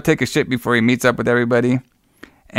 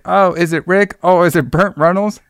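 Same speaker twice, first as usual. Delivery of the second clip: higher, sing-song mock guessing; mock-coy girlfriend on a lakeside bench; playful guessing girlfriend; 6 semitones higher than usual. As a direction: play along coyly as if you don't know who it is. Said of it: "she's like".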